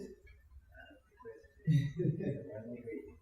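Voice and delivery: whispered, unvoiced prank call voice; soft Reagan whisper. DJ on the phone as Reagan, hushed. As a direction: murmur it like a hushed president. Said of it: "thank them as Reagan".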